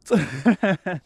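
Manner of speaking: quick bright laugh; short laugh. Real, not acted. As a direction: laugh it off lightly. Laugh after "cold.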